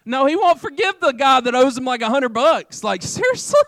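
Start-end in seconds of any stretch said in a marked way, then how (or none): 2.8-3.6 high-pitched disbelieving question; incredulous. Acted. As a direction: jump up high in exaggerated disbelief. Incredulous at the unforgiving servant.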